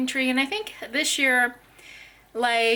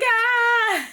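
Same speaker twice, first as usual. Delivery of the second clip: high startled "Ah" breaking into a laugh; retold scream; 13 semitones higher, 5 dB louder than usual. Mom reenacting her scare at the toddler, laughing yelp. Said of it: "'Cause I was like".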